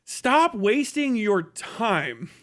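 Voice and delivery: ordinary delivery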